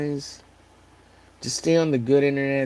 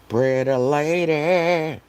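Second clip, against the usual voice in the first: mock voice; 4 semitones higher, 5 dB louder, much slower than usual. Mock voice while drawing.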